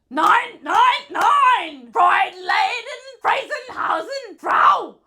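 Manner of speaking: high, shouted mock German; German accent. Player overdoing the German round, shouted German accent. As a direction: yell gibberish in a hammed-up German accent.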